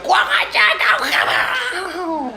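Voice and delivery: high, drawn-out enraged yell; furious shout. Acted. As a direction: bellow it like an enraged tyrant. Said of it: "mengamuk Abu Jahal". (jeritan marah Abu Jahal)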